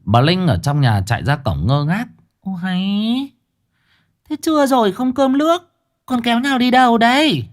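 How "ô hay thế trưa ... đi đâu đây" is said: put-on high-pitched fussing old woman; old wife calling out. Old wife calling after the car, high and fussing. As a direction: scold them as they drive off.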